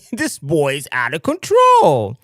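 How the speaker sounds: mock-exasperated mom, stretched and loud; exasperated mother. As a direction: cry it out slowly like a fed-up mother. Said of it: "his mother said".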